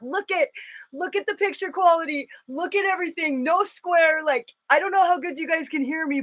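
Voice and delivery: high-pitched, eager gushing; excited showing-off. Real, not acted. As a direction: show it off in a high, thrilled voice. Excitedly shows off new picture quality.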